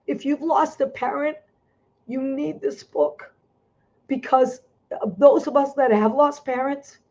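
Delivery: pushing through so she won't cry; holding back tears. Real, not acted. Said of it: "I'm gonna try not to cry".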